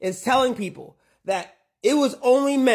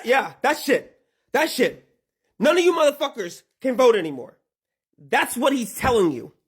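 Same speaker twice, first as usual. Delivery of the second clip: ordinary delivery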